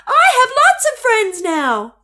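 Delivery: high, loud happy echo; echoed shout. A narrator's reading. The echo shouting the happy news back, high loud shout. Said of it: "a voice answered him".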